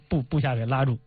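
usual speaking voice

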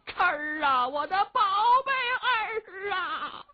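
high, drawn-out crying wail; old mother wailing. A narrator's reading. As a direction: wail over her bound son. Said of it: "老太太哭啊".

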